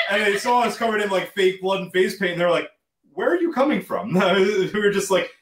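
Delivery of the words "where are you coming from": high-pitched mock-baffled question; imitated officer in disbelief; incredulous cop. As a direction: play the cop taken aback, pitch jumping up. Acted police questioning band in fake blood.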